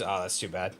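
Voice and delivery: normal talking voice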